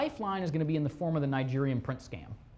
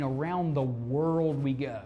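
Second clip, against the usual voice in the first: ordinary talk, nothing marked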